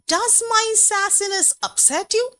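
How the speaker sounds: high-pitched sarcastic question; mock the critics with a taunting question; lightly acted sarcasm, pitched high; ridiculing. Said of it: "Maya Angelou ridicules them".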